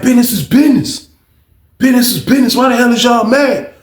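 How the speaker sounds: high-pitched retold protest; indignant retort. Fat Craig defends himself in quarrel.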